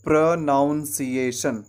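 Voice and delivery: slow "प्रोनाउंसिएशन" with "noun" as second syllable; common mispronunciation. Shown as a demonstration. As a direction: say "प्रोनाउंसिएशन" slowly, stretching the wrong "noun" sound. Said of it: "हम इस तरीके से कहते हैं".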